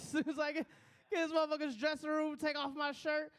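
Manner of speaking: ordinary talk, nothing marked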